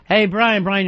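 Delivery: normal talking voice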